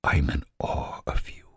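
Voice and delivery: breathy unvoiced whisper, close to the ear; soft admiring whisper. A narrator's reading. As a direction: whisper it in awe, barely audible. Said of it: "he whispers".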